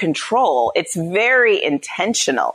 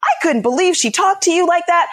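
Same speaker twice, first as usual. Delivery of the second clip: high-pitched imitation of disbelief; shocked husband; 12 semitones higher than usual. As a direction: voice a husband aghast on your behalf.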